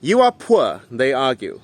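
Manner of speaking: ordinary talk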